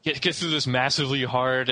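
ordinary talk

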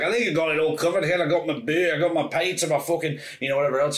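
put-on quick Scottish pub drunk rattling off his shopping; imitating a drunk old Scotsman, fast. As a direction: loose Scottish accent, boozy and quick. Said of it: "old drunk Scottish papa".

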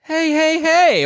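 high mock "hey, hey"; mock protest. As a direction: call out at the kids taking candy. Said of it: "I'm sitting here going".

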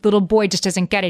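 ordinary talk, nothing marked

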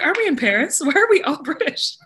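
high, loud comic confusion; baffled outburst, played for laughs; mock bewilderment. Acted puzzlement at mixed accents.

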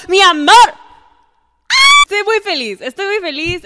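high shrieking joy that fades; squealing, overjoyed; excited shout. Real, not acted. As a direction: scream with delight then settle. Bursting with excitement.

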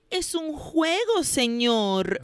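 put-on high, sweet little-girl act; innocent little voice. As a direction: play innocent in a tiny high voice. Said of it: "with the most innocent little voice".

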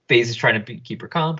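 ordinary delivery

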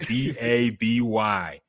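slow letter-by-letter spelling. Shown as a demonstration. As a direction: spell it out slowly to correct her. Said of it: "like, it's baby".

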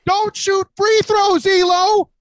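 insisting loudly over the other guy. Real, not acted. Heated debate about free throws.

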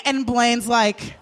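normal talking voice

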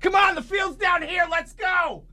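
put-on high shouted call to a lost kid; mock coach shouting come on. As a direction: yell it across the campus. Voicing Chet calling the kid.

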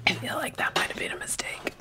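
whispering through chili burn; barely voicing it through the heat; strained whisper. Real, not acted.

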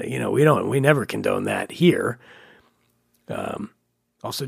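ordinary talk

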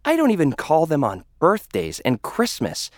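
ordinary talk, nothing marked

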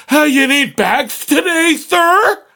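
high chirp climbing to the end; mock robot cashier. Cashier asking a customer about bags, mocking high robot voice. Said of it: "do your robot little".

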